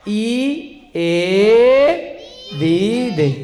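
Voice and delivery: ई, ए, दी, दे drawn out one at a time; endings sounded out. Shown as a demonstration. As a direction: dictate each of ई, ए, दी, दे separately with long vowels. Dictating verb endings to students. (ई, ए, दी, दे को खींचकर, अलग-अलग)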